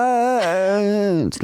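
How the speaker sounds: long, high mock scream at normal volume; drawn-out high cry, acting out terror; retold scream. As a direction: stretch it into a high re-enacted yell. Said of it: "Loud, yeah, scream".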